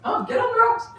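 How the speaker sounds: put-on high, excited little kid's squeal; excited child. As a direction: squeal it like a thrilled kid hopping across rocks. Kids excited hopping on rocks.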